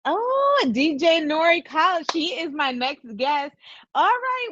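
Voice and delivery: high, bright announcer hype; excited. Real, not acted. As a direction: hype up the next guest. Excited announcing next guest.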